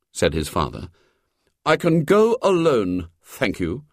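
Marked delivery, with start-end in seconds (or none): 1.7-3.8 put-on a boy's stiffly dignified reply, starting high; icily dignified, raised pitch settling on the thanks; icy dignity. A narrator's reading. William refusing his father's company, with icy dignity. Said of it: "with icy dignity".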